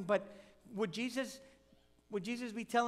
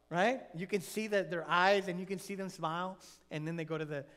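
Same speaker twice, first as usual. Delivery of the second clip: ordinary talk, nothing marked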